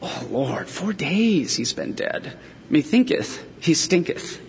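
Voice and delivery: soft, hushed mock-archaic complaint; mock Martha. Martha warning Jesus, a hushed wry complaint. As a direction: murmur it like a wry aside. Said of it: "Martha says".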